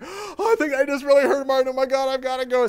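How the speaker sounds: high, loud frantic blurting; acted panic. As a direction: blurt it as if about to run away. Acting out man fleeing in panic.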